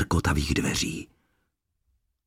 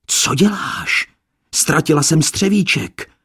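much higher, louder scolding; angry cry; 9 semitones higher, 5 dB louder than usual. Acted. Lesana shouting angrily at Adam for losing her slipper. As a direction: snap at him, furious at the lost shoe. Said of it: "se rozkřičela zlostí".

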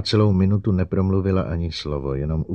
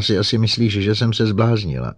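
raised, loud outburst; blurted out; 5 semitones higher, 5 dB louder than usual. A narrator's reading. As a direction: force it out of yourself, voice up. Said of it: "vyrazila ze sebe doktorka Kelvinová".

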